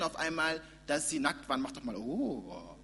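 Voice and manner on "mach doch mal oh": seductive whisper. Acted. Tempting voice again.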